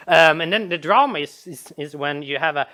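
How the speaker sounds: ordinary talk, nothing marked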